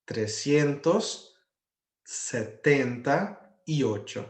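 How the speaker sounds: trezentos setenta e oito, said slowly with each word spaced apart; slow Spanish demo. Shown as a demonstration. Teacher to students, slowly modelling the Spanish number. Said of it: "em espanhol vai ficar".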